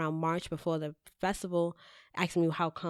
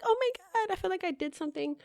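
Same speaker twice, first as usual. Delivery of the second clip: retold delight; 13 semitones higher, faster than usual. Host retells pride in helping a young intern, high delighted gush. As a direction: replay being touched, voice way up high.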